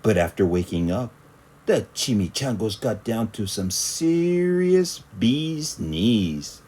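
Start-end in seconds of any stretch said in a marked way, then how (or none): none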